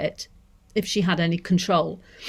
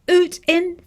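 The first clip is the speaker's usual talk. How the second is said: hint of Scottish "oot"; 11 semitones higher, 4 dB louder than usual. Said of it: "I can't exactly say it in the way that it was delivered".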